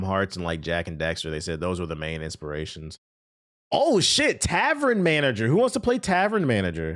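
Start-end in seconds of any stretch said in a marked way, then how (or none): 3.7-6.9 excited. Real, not acted. Excited at spotting a game.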